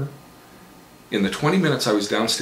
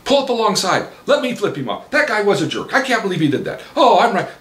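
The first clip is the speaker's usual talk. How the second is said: put-on eager girlfriend; 12 semitones higher, 6 dB louder than usual. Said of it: "my girlfriend ... goes, says".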